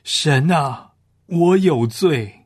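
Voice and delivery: tearful cry. Acted. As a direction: call out slowly to God, pleading guilt. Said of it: "他乃是眼中含泪呼喊道".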